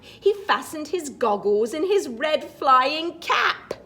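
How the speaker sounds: put-on lively read-aloud for children; storybook reading. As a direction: read it like a bedtime picture book. Reads the picture book rhyme.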